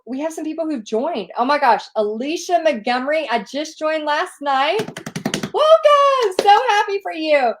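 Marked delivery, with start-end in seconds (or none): 5.5-7.5 much higher, louder gushing welcome; excited welcome. Real, not acted. Host greets Alicia who just joined, gushing. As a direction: squeal a welcome to a new friend. Greeting a new member live.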